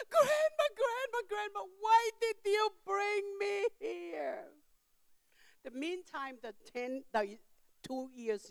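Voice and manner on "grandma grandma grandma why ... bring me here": much higher tearful wail, fading in volume; imitating crying child. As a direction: plead through mock tears, dying down. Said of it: "start crying at the same time".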